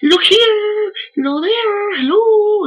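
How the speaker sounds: very high squeaky talking bird; parrot voice. Retold or imitated. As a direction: squawk it like a parrot. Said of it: "She'd be like".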